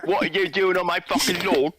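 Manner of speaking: cockney yell at a trespasser; British accent. Pretend. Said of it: "it's a good British accent".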